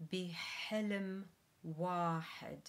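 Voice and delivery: careful Arabic model phrase; Arabic dictation voice. Shown as a demonstration. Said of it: "the translation to Arabic is".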